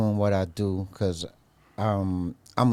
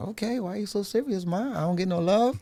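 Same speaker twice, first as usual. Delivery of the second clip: higher, fast, playful whine; mock whiny complaint; 10 semitones higher, faster than usual. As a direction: tease mom for being so serious. Retold self teasing serious mom.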